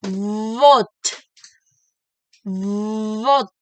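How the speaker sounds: Vote with a long buzzing V on the lip. Shown as a demonstration. Teacher models the voiced V, buzzing. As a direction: hum the V of Vote. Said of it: "like this".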